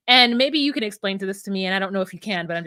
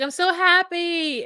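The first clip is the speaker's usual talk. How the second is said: mock happy; 7 semitones higher, slower than usual. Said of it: "she's like".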